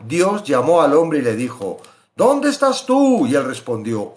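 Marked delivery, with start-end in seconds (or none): 2.2-3.3 slow, high call of God searching. Acted. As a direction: call out as God seeking Adam. Voicing God calling Adam. (la voz de Dios llamando, alta y lenta)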